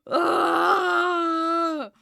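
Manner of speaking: high loud wailed ugh; high, loud anguished ugh; loud frustrated groan. Real, not acted. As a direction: groan out in despair.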